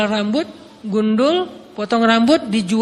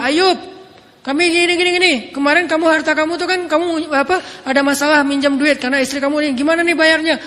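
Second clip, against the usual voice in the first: menagih dari luar rumah; 6 semitones higher than usual. Retold or imitated. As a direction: call through the door like pushy relatives. Keluarga menagih utang dari luar. (keluarga menagih dengan suara keras)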